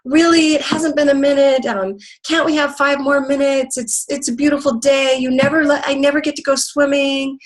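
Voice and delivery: put-on high, pleading little-girl whine; whining child. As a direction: beg like a kid who won't leave the pool. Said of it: "she was whining".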